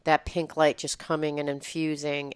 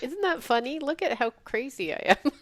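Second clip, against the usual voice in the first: higher, light and amused; higher voice, laughing at her own craziness; amused at herself; 9 semitones higher than usual. Retold or imitated. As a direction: shrug it off with a chuckle in the voice. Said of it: "But instead it's just like".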